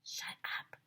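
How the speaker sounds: soft unvoiced shut up; whispered shut up. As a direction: hush them with a whisper. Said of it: "which means".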